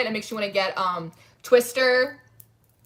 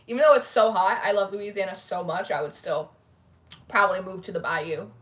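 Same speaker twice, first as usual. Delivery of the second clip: usual speaking voice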